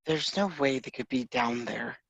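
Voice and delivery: softly muttered disbelief, in character; hushed, mildly baffled aside; confused. Acted. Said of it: "confusedly also is looking at the ocean".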